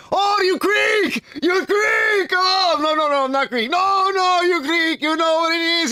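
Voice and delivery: very high, shouting excited shopkeeper; overjoyed restaurant owner shouting in a high voice; excited Greek cook. Retold or imitated. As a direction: gush like a thrilled Greek counterman. Excited Greek shop owner retold.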